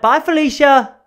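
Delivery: high, loud, showy holler; catchphrase call-out. Shown as a demonstration. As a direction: shout it out high like a gleeful catchphrase. Demonstrating the catchphrase at full volume.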